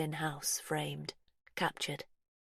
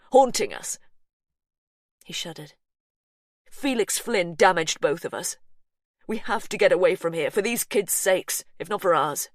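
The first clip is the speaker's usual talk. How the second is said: louder, intense plea; Hugh, haunted urgency; 6 dB louder than usual. A narrator's reading. Hugh begging Verity to leave the house, intense. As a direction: drive home the danger.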